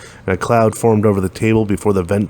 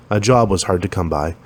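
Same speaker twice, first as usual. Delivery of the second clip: ordinary delivery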